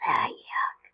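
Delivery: a quiet whispered groan of disgust; whispered yuck. Real, not acted. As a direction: breathe out the disgust under your breath. Disgusted aside.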